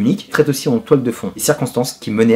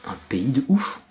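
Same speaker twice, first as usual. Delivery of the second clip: whisper; 6 dB quieter than usual. Real, not acted. Aside about crazy country.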